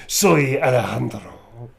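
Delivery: slow, sultry Spanish; slow seductive Spanish voice; sexy. Acted. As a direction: drag it out seductively. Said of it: "It's so sexy".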